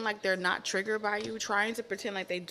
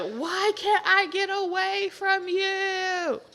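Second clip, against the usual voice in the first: stretched-out high whine, much higher; slow high wail; 11 semitones higher, 4 dB louder, much slower than usual. Retold or imitated. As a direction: moan it out slowly in exasperation. Acted exasperated person.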